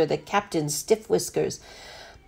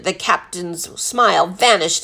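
usual speaking voice

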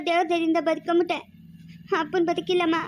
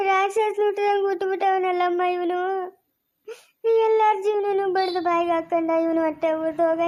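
tearful cursing. Acted. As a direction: curse him through sobs. Wronged woman wails and curses him. (ಅಳುತ್ತಾ ಶಪಿಸುವ ಧ್ವನಿ)

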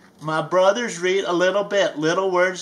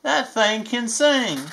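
usual speaking voice

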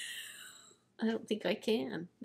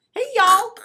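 high, loud Southern hey y'all; Texas drawl; 13 semitones higher, 13 dB louder than usual. Pretend. Introducing herself as from Texas, in a Texan drawl. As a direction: put on a big Texan twang. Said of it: "I'm from Texas".

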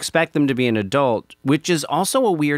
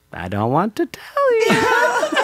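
much higher, slow, laughing mock child; laughing kid imitation; slower than usual. Dad imitates his son's refusal, high and laughing. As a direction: squeak it out slowly through laughter like a cheeky kid. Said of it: "He goes".

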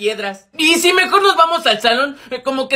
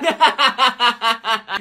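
long cackle at gossip; mocking laughter; 2 semitones lower than usual. Gossiping to a friend about a dyed hairdo, mocking laughter. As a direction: burst out laughing at someone's expense.

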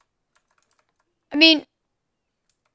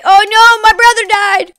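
mock alarm; 5 semitones higher, 7 dB louder than usual. Mock alarm at brother's death in game.

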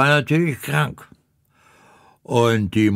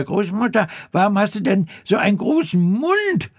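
put-on much higher, quick childlike question; little girl voice; 7 semitones higher, much faster than usual. Rotkäppchen asks the disguised grandmother, high and childlike. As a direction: ask like a wide-eyed little girl.

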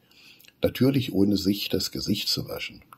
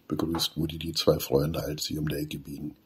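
ordinary delivery, nothing marked

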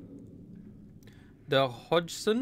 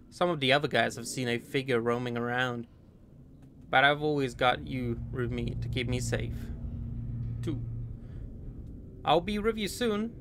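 put-on American accent. Player reading a soldier's letter to his girl, light American accent. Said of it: "I don't know if the accent fits".

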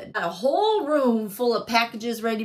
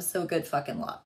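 normal talking voice

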